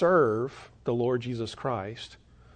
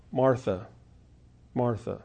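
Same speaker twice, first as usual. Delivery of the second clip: lower, softer calling of her name; gentle rebuke; 4 semitones lower, 4 dB quieter than usual. Acted. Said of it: "you can just hear the words that way".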